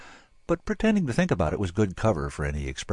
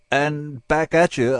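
slow, higher, louder, with a fake quaver; 8 semitones higher, 6 dB louder, slower than usual. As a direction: feign nerves with a wobble in the voice. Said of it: "putting a quaver in it".

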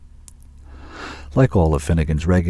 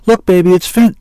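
high, loud, hurried call; urgent warning; 12 semitones higher, 9 dB louder than usual. A narrator's reading. Zack turns quickly to Jill, urgent warning. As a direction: call it out to alert your partner.

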